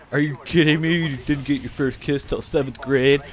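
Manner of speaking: incredulous viewer taunting him; lightly voiced mocking commenter. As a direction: scoff like a snarky commenter. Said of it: "Leave me a comment, tell me".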